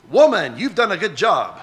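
mock-formal praise, pitch swinging widely; lightly hammed-up compliment with stilted formality; overly formal address. Preacher demonstrating an odd way to praise a woman, mock-formal. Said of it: "who talks like that".

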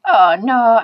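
loud, acted cry of dismay. Dog hearing grasshopper will jump on its head, loud dismay. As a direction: burst out in mock dismay.

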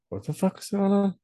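a squeaky, disbelieving what-the-heck; shocked disbelief. Retold or imitated. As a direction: imitate your own stunned reaction. Retelling own shocked reaction.

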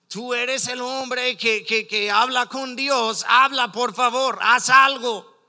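urgent plea building to a loud cry; desperate pleading. Retold or imitated. Preacher voices a woman begging the prophet for help, desperate pleading. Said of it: "Ella desesperaba".

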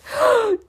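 very high, loud theatrical gasp; mock gasp. Reader mocks Marshall's shock, gasping. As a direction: gasp in overdone horror.

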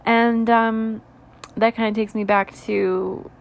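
normal talking voice

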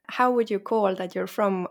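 usual speaking voice

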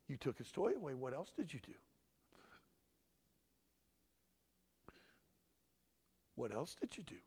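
soft, controlled parent voice; methodical. Acted. Dad drawing a confession from his child, quiet and methodical. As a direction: press the child quietly, without emotion. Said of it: "it's very methodical".

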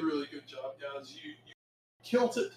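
ordinary talk, nothing marked

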